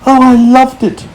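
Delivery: put-on high small boy voice; delighted child's answer; young boy James. A narrator's reading. James answering the grasshopper, high boyish delight. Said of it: "James answered".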